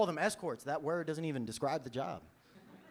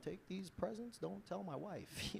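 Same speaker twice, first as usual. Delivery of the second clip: secretive hush; 4 semitones higher, 12 dB quieter, slower than usual. Retold or imitated. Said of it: "America's way of being like".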